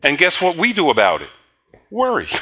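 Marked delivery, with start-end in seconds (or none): 1.9-2.3 high, drawn-out mock answer; mock worry. Preacher mocking the flock's answer, high and teasing. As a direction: say it like the obvious punchline.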